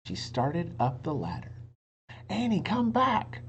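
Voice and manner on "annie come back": Jack worried. A narrator's reading. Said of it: "said Jack".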